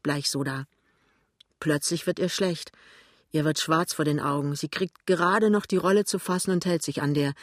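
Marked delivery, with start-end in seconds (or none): none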